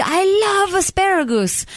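high-pitched, over-the-top delight; mock gushing. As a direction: gush about it like a smitten convert. Acting out a gushing dictator.

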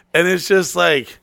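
ordinary talk

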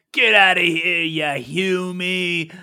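loud, high dwarf, drawing out humie slowly; spitting dwarf insult. Acted. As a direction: hurl it back like a furious dwarf. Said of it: "the dwarf spits back at you and says".

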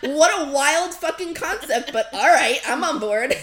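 loud, laughing exclamation; big, laughing enthusiasm; amused disbelief. Real, not acted. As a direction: marvel at it through laughter, then give in gladly. Laughing at the wild concept.

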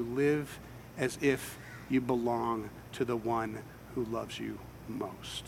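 slow and soft, dropping to a breathy whisper; preacher whispering a comfort to the congregation; hushed tender whisper. Real, not acted. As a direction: let it sink in, almost whispered. Tender closing line of a point.

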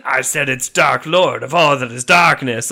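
a devil insisting on his grand title; pompous Dark Lord. Acted. Satan corrects title again.

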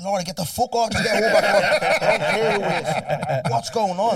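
loud, high, laughing yell; laughing shout. Retold or imitated. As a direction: yell her out the door, cracking up. Retold shouting at friend, laughing.

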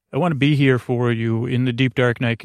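ordinary delivery

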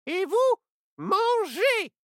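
sharp high witch. Acted. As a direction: shoo it away like a witch. Witch orders children.

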